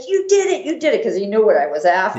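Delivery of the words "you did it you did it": high, excited mock cheer; cheering supporter. Teammate pointing at his watch to the runner, excited. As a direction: imitate a friend celebrating, pitch up high. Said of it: "He goes".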